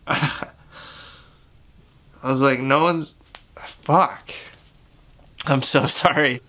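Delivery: choking up, breaking off with small laughs; real tears coming, struggle to get words out; tearing up. Real, not acted. Apologising to viewers mid-story, tearing up. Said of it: "starting to tear up".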